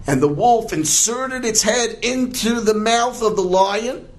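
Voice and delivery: high-pitched, tense storytelling; suspenseful narration. A narrator's reading. Storyteller as the wolf puts its head in, high suspense. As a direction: hold it high all through for suspense.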